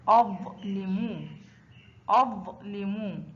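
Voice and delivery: أظلم slow, split after the closed أظ; sukun mid-word. Shown as a demonstration. Teacher models ẓa mid-word, أظلم slow and split. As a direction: say أظلم drawn out, resting on vowelless ظ. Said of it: "وسط الكلمة مثل".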